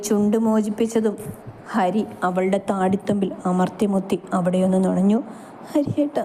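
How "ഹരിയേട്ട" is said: high, cracked, breathless call of his name; husky voice. A narrator's reading. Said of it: "ചിലമ്പിച്ച അവളുടെ സ്വരം". (ചിലമ്പിച്ച സ്വരത്തിൽ ഹരിയെ വിളിക്കുന്നു)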